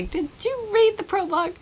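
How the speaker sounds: high-pitched, teasing check on the readers; pointed mock question. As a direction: ask it high, like a pointed reminder. Pointed reply to asking readers.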